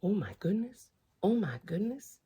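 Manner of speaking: usual speaking voice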